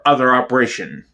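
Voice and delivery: ordinary delivery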